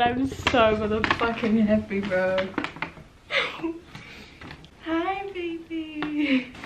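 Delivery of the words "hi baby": soft, slow, higher coo; tender greeting. Real, not acted. Greeting the new jacket softly like a baby. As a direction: coo to it like a newborn.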